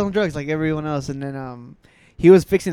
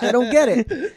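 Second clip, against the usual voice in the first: high, louder, amused confusion; laughing bafflement; 7 semitones higher, 6 dB louder, slower than usual. Retold or imitated. Recalling the slang to laughing friends, amused bafflement. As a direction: protest it through a laugh. Repeats puzzlement while laughing.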